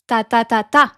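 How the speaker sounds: French rhythm, three even "ta"s then a strong "ta"; last-syllable stress. Shown as a demonstration. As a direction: land hard on the fourth "ta". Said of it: "you stress la dernière syllabe".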